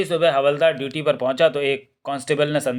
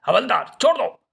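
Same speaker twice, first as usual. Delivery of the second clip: shouting in his real voice; 8 semitones higher than usual. Acted. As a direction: yell it out to get free. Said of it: "अपनी असली आवाज़ में चिल्लाए".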